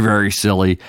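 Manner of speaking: ordinary talk, nothing marked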